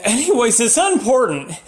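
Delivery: usual speaking voice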